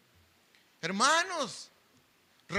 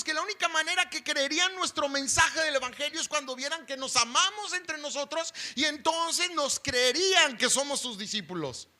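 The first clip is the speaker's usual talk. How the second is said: normal talking voice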